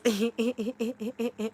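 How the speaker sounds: high, loud cackling laugh. Real, not acted. Streamer cracking up after speaking French, high laugh. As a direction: burst into a high rapid laugh. Excited outburst after repeated yeses.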